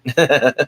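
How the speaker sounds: short, light laugh; a brief chuckle to yourself; real chuckle. Real, not acted. Streamer telling chat caramel is the favorite, chuckling.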